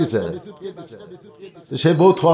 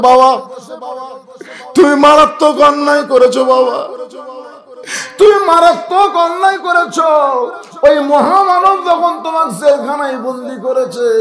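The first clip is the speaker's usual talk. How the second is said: higher, louder tearful reproach; a son weeping as he blames his father; boy crying out to his father; crying child; 8 semitones higher, 3 dB louder than usual. Acted. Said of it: "ছেলেটা কাঁদতেছে আর বলতেছে".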